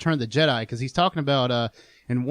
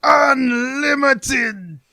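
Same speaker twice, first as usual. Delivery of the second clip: hugely drawn-out, high mock villain roar; hammed-up screaming villain; Palpatine; 11 semitones higher, 6 dB louder, much slower than usual. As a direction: shout it like a power-crazed emperor, stretching every syllable. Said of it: "Palpatine impression".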